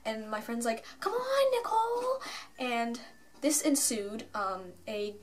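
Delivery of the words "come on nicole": high-pitched imitation of someone calling out; mock yelling. Friend hurrying her into line, high mock yell. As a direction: call her over in a high, strained yell. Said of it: "like, yelling".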